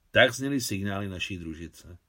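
ordinary delivery, nothing marked

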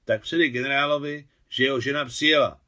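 firm military instruction; commanding order. A narrator's reading. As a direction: order a subordinate to pass it on. Officer gives courier an order.

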